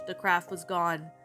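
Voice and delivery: plain reading voice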